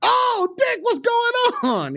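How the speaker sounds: high, drawn-out sweet hello; mock cheerful greeting. [name] imitates his own nervous greeting to Dick, high and slow. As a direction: gush it like you're thrilled.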